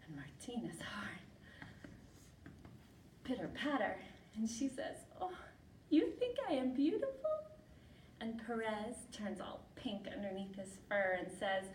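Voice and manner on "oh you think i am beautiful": high, lilting question; louder, high-voiced delighted query; flattered surprise. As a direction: pitch it way up, touched and disbelieving. Martina flattered by the mouse.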